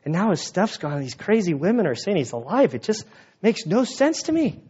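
ordinary talk

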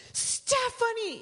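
high, loud, stretched out calling a name; excited call. Retold or imitated. Surgeon excitedly calls his patient awake on the table. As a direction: call her name like great news broke. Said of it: "and he goes".

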